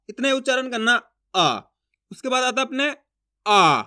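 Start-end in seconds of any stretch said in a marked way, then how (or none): 3.5-3.8 आ drawn out long, low and loud; long stressed aa. Shown as a demonstration. Teacher showing students the long vowel, pushed out low and loud. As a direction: push hard on the long आ. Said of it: "इसके उच्चारण में थोड़ा जोर लग रहा है".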